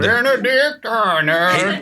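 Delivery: very slow, drawn-out American accent, lightly put on; mock American accent. Host topping the echo in a lightly put-on American accent. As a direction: stretch every syllable in a hammy American accent. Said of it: "Hence the accents".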